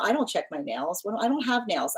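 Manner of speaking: normal talking voice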